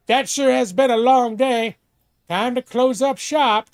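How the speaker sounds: hammed-up small-town merchant; folksy shopkeeper. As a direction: end the day like an old-timey clerk. Shopkeeper voice closing the store.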